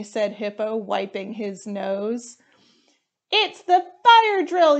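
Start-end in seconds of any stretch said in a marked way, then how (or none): 3.3-4.8 high, loud excited yell; much higher, loud shout of alarm. Acted. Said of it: "yelled Co-, Cockroach".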